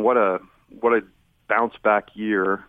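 ordinary talk, nothing marked

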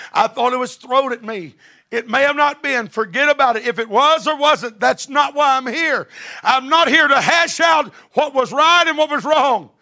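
high, loud preacher shouting it out; full-force preaching. Acted. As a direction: build the sermon to a ringing shout.

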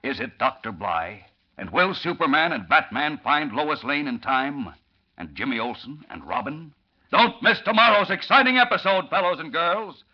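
rising, loud serial cliffhanger; old radio announcer. Acted. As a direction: hype the next episode big. Announcer closes episode.

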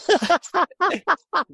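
squealing high laugh; high cackle. Real, not acted. Cracking up at his own rhyme parody, squealing laugh. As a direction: crack up at the punchline.

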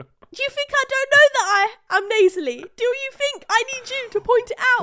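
high, loud indignant rant; half-joking fury at the teasing; mock outrage. Real, not acted.

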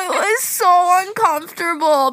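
high, loud, slow cringing whine; squirming discomfort. Real, not acted. Telling a friend about the creepy guy, cringing whine. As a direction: whine out how awkward it was.

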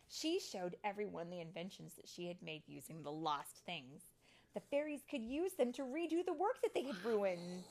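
animated storybook reading, building at the end; lively, expressive storytime voice; story narration for children. A narrator's reading. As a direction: read it to little ones, punching key words.

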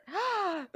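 quick high intake of breath; mock gasp. Reader reacting to foreshadowing, mock gasp. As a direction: gasp at a juicy hint. Reacts to the threat just read.

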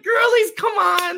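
very high, drawn-out plea; hammed-up disbelieving protest; mock exasperation. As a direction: call them out in a squeaky incredulous shout. Mock exasperation at staged TV scene.

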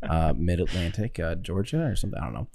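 hushed public radio announcer; breathy NPR voice. Pretend. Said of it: "Very breathy voice".

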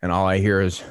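normal talking voice

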